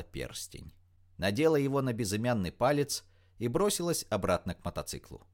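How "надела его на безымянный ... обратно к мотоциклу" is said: usual speaking voice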